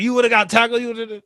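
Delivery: high, loud trash-talk yell; mocking yell. Bart mocks the touchdown, high and loud. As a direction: holler "fluke" over and over to rattle him.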